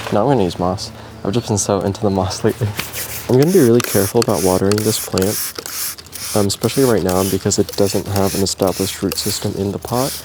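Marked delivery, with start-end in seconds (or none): none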